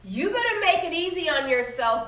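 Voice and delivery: yelled threat. Acted. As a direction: yell it down the bus at her. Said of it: "Blake yelled".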